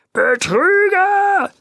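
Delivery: high, slow, stretched-out yell; the grocer screams at the swindler; shouted accusation. A narrator's reading. Grocer screaming at the supposed swindler, drawn-out high yell. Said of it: "schrie der Gemüseverkäufer".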